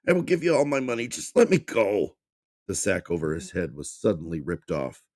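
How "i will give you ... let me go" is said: high, loud, fast panicked plea; frantic begging. Acted. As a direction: rush the bribe out in terror. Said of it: "he begged".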